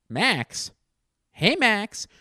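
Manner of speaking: high, loud call for someone. Pretend. Calling out a name as a bit.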